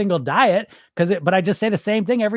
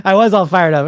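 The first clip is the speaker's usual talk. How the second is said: a louder burst, all fired up; 9 dB louder than usual. Real, not acted. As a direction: let it blast out with energy. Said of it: "You're fired up".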